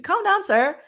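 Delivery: high mock scolding calm down, sir. Vlogger teasing houseguest for refusing gift, high mock scolding. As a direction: tease him to settle down, pitched high.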